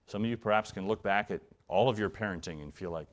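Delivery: usual speaking voice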